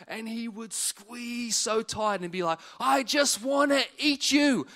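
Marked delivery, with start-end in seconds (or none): 2.8-4.7 slow, loud, higher playful growl; doting grandpa. Retold or imitated. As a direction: ham up a grandpa squeezing a kid he adores. Grandpa squeezing grandson tight.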